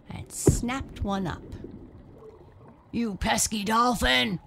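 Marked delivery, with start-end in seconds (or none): 2.9-4.4 furious sea monster roar. A narrator's reading. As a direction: roar it at the thief. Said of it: "roared a voice".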